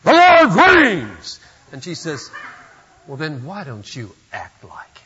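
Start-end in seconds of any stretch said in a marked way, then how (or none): 0.0-1.4 much higher and louder, roaring; furious shout. Retold or imitated. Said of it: "in his typical Martin Luther way, smashed the table". 3.1-5.0 whispering wife turning the tables. Retold or imitated. Wife's quiet retort to Luther.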